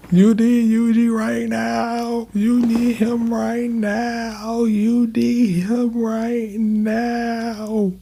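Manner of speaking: hammed-up high whine; high mock plea. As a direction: drag it out high like a desperate fan. Pleading in a high put-on voice.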